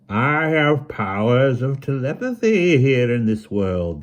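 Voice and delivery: slow, menacing villain voice. A narrator's reading. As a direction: loom over them, slow and menacing. Autarch villain speaks.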